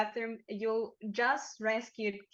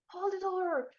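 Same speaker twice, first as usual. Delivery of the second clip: high-pitched shout from a distance; acted yelling; 9 semitones higher, 4 dB louder, slower than usual. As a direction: yell it high, as if running for a door. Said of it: "you hear someone yelling".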